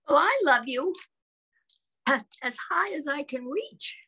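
high, eager little hare; stretching up as a small child. Acted. Reading to a baby, the little hare in a high voice.

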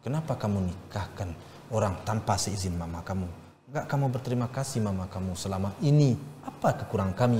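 low, soft retold reproach; restrained anger. Father confronts child over marrying without permission, restrained anger. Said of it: "saya tetap marah".

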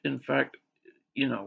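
usual speaking voice

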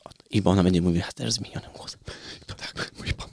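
whispering. Real, not acted. Hushed joking about masked guest.

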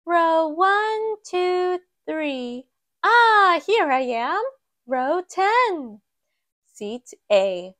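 high little mouse voice counting rows; Maisie's voice. Acted. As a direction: play the small heroine finding her seat, chirpy and high. Maisie counts rows to her seat.

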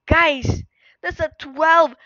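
fast, loud, high excited casting; live play-by-play. Acted. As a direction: call the teamfight at full speed.

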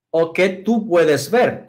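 ordinary talk

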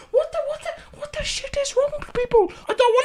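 high-pitched squeal of horror; appalled disbelief. Real, not acted. Player refusing a painful question, high appalled squeal.

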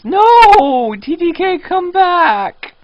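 high, loud cry after him; shrill loud plea, way up high; desperate call. Real, not acted. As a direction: yell for him to come back. Calling after a player leaving.

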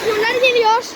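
loud urgent warning; yelling a warning to a friend as trolls close in; alarmed shout. Pretend. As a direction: shout that the trolls are coming.

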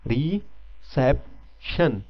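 Reception drawn out slowly, three clear syllables; slow, deliberate classroom pronunciation; slow syllable demo. Shown as a demonstration. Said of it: "अभी आप pronounce करें इसको".

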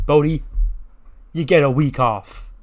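loud, grand declaration; teacher cheerfully calling out the news; mock big announcement. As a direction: announce it proudly to the room. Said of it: "Andre Chase goes".

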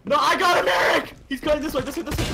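high, loud excited yell; excited shout. Real, not acted. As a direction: shout the win. Chasing enemy.

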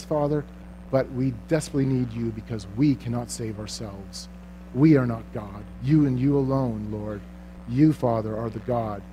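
low, soft, reverent prayer; pastor's quiet closing prayer. Acted. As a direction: pray it humbly, hushed. Let's close in a word of prayer.

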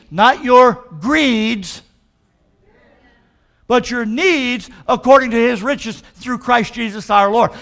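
loud, slow, drawn-out preaching; full-force preaching. Acted. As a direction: preach it out to the congregation.

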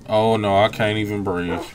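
usual speaking voice

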